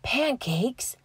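soft, high little whisper; whisper to himself. Acted. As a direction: breathe it out to himself. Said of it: "Llama Llama whispers to himself".

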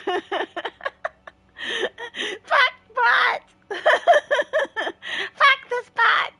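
loud, high, laughing curse at the bot; laughing outburst. Real, not acted. Streamer yelling at her game bot, high and loud with laughter. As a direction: blow up at the bot through laughter.

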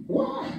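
very high martial-arts cries; Bruce Lee. Retold or imitated. Preacher imitates a movie fighter for laughs, high kung fu cries. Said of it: "Bruce Lee always wins the fight".

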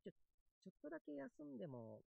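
hushed, sneaking a break; sneaky hushed. Acted. Sneaking a rest.

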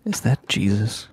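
whisper. Acted. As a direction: close secretive whisper. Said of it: "lean into Norman real close and go".

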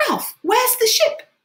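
high, loud, drawn-out question; high-pitched eager shout; excited calling out. A narrator's reading. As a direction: call out urgently in a high boy's voice. Excited boy calls to Ralph.

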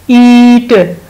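ईट said with a long, stretched ई; long ee demo. Shown as a demonstration. Teacher showing children the long vowel, stretched ee. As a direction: draw out the ई in ईट. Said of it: "long vowel है".

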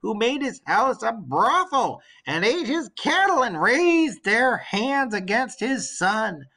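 put-on an old servant bursting with good news; old nurse, excited. Old nurse telling her queen the suitors are dead, eager and high.

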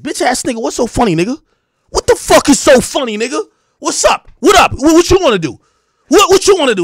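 loud, high-pitched challenge to a fight; mock angry confrontation. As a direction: imitate a humiliated man squaring up. Acting Meek confronting him after slap.

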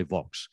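"Vaux" with a short o and a final ks; Vaux as in Vauxhall. Shown as a demonstration. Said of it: "I remember the motor cars".

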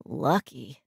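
low, sighed single word; sighed envy. A narrator's reading. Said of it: "Shane sighed".

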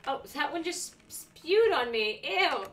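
grossed out. Real, not acted. Potion spews on her.

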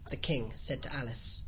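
ordinary delivery, nothing marked

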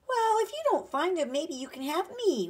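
put-on high, loud, eager child's voice; little boy. A narrator's reading. Small boy offering himself to a man, eager child voice.

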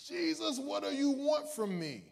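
high, slow plea to Jesus; pleading cry. Acted. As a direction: beg Jesus with a lifted voice.

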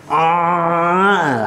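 high, sustained acted cry; drawn-out "ah". Storyteller acting the man smearing himself, exclaiming. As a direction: stretch out a high "aah".

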